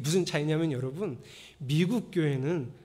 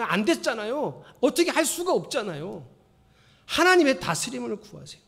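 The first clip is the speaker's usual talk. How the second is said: ordinary talk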